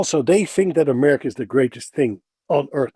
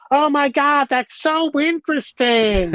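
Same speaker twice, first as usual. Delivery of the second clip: high, stretched-out gushing; mock American enthusiasm; 11 semitones higher, slower than usual. Dutch guest imitating Americans thrilled by small things, exaggerated gushing. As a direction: overdo the amazement at something tiny. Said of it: "go out of their mind to show you their enthusiasm".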